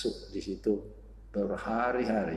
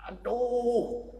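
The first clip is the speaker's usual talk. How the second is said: a cry of pain pitched far higher; demon's cry; 14 semitones higher than usual. Retold or imitated. The demon cries out as the fire strikes it. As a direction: shriek it like the demon being burned.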